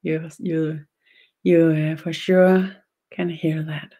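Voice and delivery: usual speaking voice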